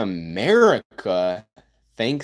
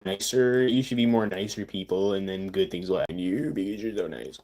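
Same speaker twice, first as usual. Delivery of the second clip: sarcastic; 5 semitones lower, 5 dB quieter than usual. Acted. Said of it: "obviously sarcasm".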